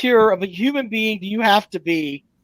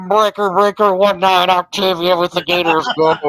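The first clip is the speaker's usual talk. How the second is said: mocking trucker-on-the-CB voice, louder; CB radio impression; 3 semitones lower, 4 dB louder than usual. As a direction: imitate a caller coming through a CB radio. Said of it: "you sound like".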